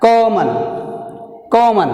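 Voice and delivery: "Common" with high, firm COM, then a fall; teacher modelling "Common", stressed at the start; first-syllable stress. Shown as a demonstration. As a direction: push COM, drop the end of "Common". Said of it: "stress is in the C".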